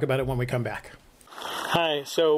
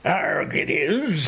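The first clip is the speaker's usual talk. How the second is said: pirate voice; much slower than usual. Pretend. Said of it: "It's Talk Like a Pirate Day".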